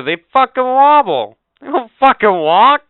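loud, high outraged rant; indignant shout. Real, not acted. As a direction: yell it in disgust, disbelief at the dogs. Ranting about French bulldogs.

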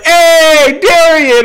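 high, loud call to a friend; acted eager greeting. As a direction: call out as the excited friend meeting in person. Acting Tyler greeting him on meeting.

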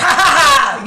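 loud, triumphant put-on laugh; gleeful mock cackle. Amateur investigator showing the thrill of finding someone out, gleeful cackle. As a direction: crow with laughter over a successful snoop. Acts out her thrill at snooping.

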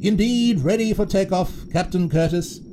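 high, dutiful toy officer; eager crew member's salute; toy first officer. A narrator's reading. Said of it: "said First Officer Zip".

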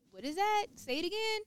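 mocking questioner. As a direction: ask it like you pretend not to understand. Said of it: "when someone mock".

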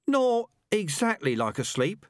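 high, drawn-out doubtful rat; uncertain. A narrator's reading. Rat questioning the comparison with sleep, uncertain. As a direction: object hesitantly, pitch well up and slowed. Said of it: "said a rat, uncertainly".

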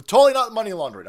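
loud, fast sarcastic jab. Real, not acted. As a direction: blurt it out, dripping with sarcasm.

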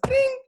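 high, loud "ding" of dropped metal; sharp high-pitched clink sound effect; imitated fork clang. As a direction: mimic a fork hitting the floor. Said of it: "dropped his fork and goes".

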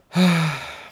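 long sigh that starts high and falls; exasperated sigh. Real, not acted. Vlogger sighing at friends' pettiness, a long fed-up sigh. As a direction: let the air out in frustration.

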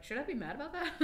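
high-pitched, amused mock outrage; mock questioning. Friend mocking people who try to stir them up, mock questioning. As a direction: ask it high, making fun of the idea.

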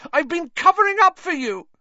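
accusing shriek, very high and loud. A narrator's reading. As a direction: shriek the accusation at full pitch. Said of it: "Hermione shrieked".

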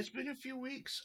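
mock-squeaky echo; high echo. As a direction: echo it back high and soft.

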